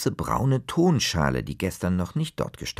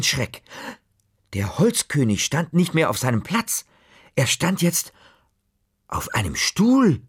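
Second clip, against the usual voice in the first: higher and louder with rising alarm; building suspense; 8 semitones higher, 5 dB louder than usual. A narrator's reading. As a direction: climb to the shock. Sudden scare climax.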